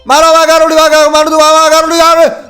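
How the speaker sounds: high, loud, urgent cheering on; frantic cheering. Real, not acted. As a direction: cheer her on like a stadium. Cheering game character on.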